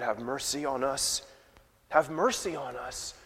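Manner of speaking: a meek prayer for mercy; humble plea. Acted.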